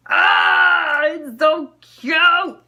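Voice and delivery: loud, slow squeal of delight; excited squeal. Real, not acted. Vlogger squealing at a cute charm, loud and slow. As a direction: gush over the cute charm.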